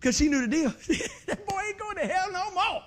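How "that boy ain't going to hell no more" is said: high, loud delighted grandma voice; grandma cheering her grandson; grandmama's joy. Retold or imitated. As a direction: play a happy old grandmother. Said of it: "Grandmama did".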